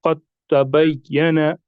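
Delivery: slow "क़द तबय्यन" with the द dropped; "क़द तबय्यन" drawn out, doubled t replacing the d; merged pronunciation. Shown as a demonstration. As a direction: recite "क़द तबय्यन" slowly as "qat-tabayyana". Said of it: "हमने दाल को छोड़ दिया".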